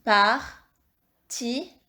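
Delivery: "Partition" in three slow, separate syllables; syllable-by-syllable dictation. Shown as a demonstration. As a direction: dictate "Partition" slowly, pausing as par, ti, sion. Teacher repeats dictated word by syllables.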